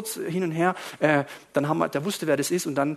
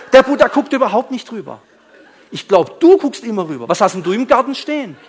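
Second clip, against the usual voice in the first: loud, raised-pitch acted rebuttal; heated retort; 6 semitones higher, 11 dB louder than usual. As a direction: fire back in mock indignation. Acting out his heated rebuttal.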